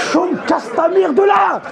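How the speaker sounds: high, loud exasperated yell; mock furious yell at a child; yelling at the kid. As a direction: shout her out of the bed. Yelling at daughter in bed.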